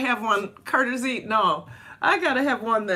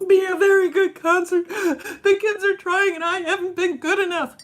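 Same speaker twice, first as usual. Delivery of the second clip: high, teary, sobbing self-quote; high crying voice, replaying the breakdown; retold crying; 10 semitones higher, 3 dB louder than usual. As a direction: wail it like you were crying then. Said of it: "I started crying at seven".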